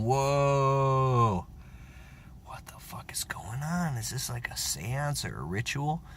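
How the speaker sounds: lightly voiced vegan friend, hushed and baffled; a hint of a stunned vegan whispering; imitating vegan friend. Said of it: "He's a vegan sitting there going".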